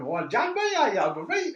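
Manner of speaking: loud, high, hammed-up Geordie; badly faked Geordie accent, played for laughs; mock Geordie accent. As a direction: shout it in comic Geordie. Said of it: "well, Geordie".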